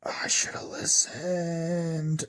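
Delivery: very slow, drawn-out whisper; regretful whisper. A narrator's reading. Watcher's dread after seeing the footage.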